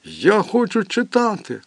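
high, lightly crying plea. Acted. Crying voice begging to read, mild tears. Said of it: "Він усе плакав і плакав".

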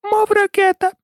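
tiny, very high squeak; feeble squeaky voice. A narrator's reading. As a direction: squeak like a small, weak creature. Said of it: "Останнім почувся кволий, писклявий голосок". (кволий писклявий голосок)

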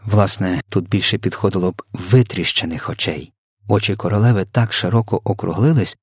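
plain reading voice

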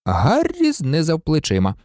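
usual speaking voice

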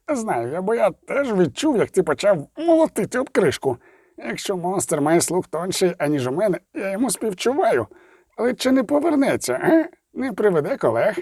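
grumble, then lift into a high worried question; animated dwarf griping at the witcher; talkative dwarf. Acted. Zoltan complains and worries.

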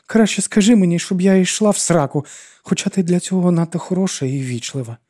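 high plaintive self-pitying complaint; woeful high-pitched lament; pitiful wail. A narrator's reading. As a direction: keep wailing, high and miserable. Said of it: "жалісливо завила вона".

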